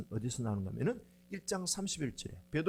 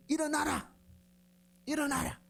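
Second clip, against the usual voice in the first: Jesus commanding; 11 semitones higher than usual. Acted. Jesus to the paralysed man lying on a litter, commanding. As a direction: order a sick man to rise, pitched well up. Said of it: "예수님이 뭐라 하는가 하면은".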